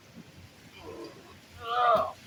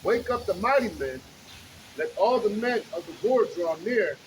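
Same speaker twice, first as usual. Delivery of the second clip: slow, loud preaching read; booming street-preacher reading; scripture reading; 3 semitones higher, 4 dB louder, slower than usual. Acted. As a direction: proclaim the verses to the crowd. Reads scripture aloud on street.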